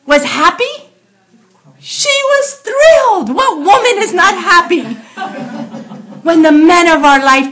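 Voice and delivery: loud, high, impassioned sermon voice; fiery preaching. Acted. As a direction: preach it with full force and fervour. Preaching at full force.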